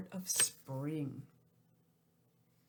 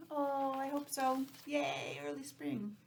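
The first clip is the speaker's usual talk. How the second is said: excited; 6 semitones higher, slower than usual. Real, not acted. Cheering for early spring.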